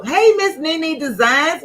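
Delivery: usual speaking voice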